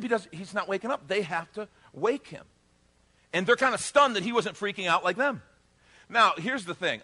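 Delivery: ordinary delivery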